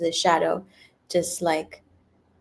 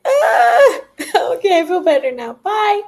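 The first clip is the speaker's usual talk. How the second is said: high, loud laughing burst of relief; 13 semitones higher, 9 dB louder than usual. Retold or imitated. A yoga teacher recalling laughing after crying.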